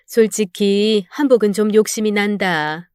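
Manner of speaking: ordinary delivery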